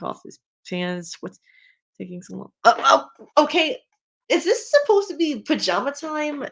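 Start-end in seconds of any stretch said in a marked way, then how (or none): 2.6-6.5 high, startled and baffled; surprised. Real, not acted. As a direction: react with startled disbelief. Reacting to the fanfic.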